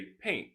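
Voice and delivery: "paint" ending on the N, no T; dropped T. Shown as a demonstration. Teacher shows quick-speech form to learners, "paint" without T. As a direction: let "paint" end before the T. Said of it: "if you speak quickly".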